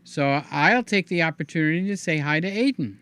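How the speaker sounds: ordinary delivery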